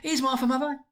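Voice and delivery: put-on bright young daughter calling out; girl's voice. A narrator's reading. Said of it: "said a girl".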